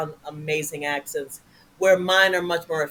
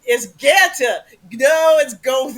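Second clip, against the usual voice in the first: put-on slow, loud, higher Chicago accent; Chicago guy; 7 semitones higher, 7 dB louder, slower than usual. Chicago guy argues over a street name, slow loud Chicago accent. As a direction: argue like a Chicago local, drawn out. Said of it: "do Chicago guy".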